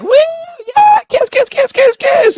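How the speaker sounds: very high, loud excited chant; squealing cheering fans. Retold or imitated. Podcaster mimicking commenters cheering for a kiss, high-pitched squeal. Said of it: "Everybody in the comment section is like".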